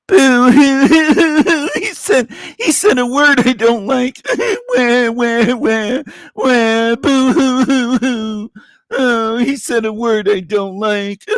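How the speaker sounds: high, slow fake wailing and whining; mock crybaby. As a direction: wail like a baby over a word. Mocking offended viewers as crybabies.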